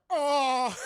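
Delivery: loud, high mock groan; hammed-up wounded moan; pained groan. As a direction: groan out in exaggerated pain. Father in pain, retold.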